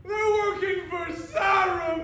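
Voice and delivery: alarmed shouted reveal; loud, urgent announcement; dramatic shout. Acted. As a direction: cry out the twist. Dramatic revelation.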